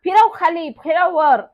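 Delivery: put-on girl hollering instructions in wild glee; excited calling out. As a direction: shout it while jumping on the pump. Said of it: "भराभरा उड्या मारत ... म्हणत होती".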